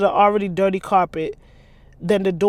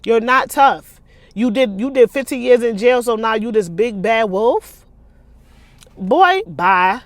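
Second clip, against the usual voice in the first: mock Donnie; 3 semitones higher, 7 dB louder than usual. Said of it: "Donnie already know, like".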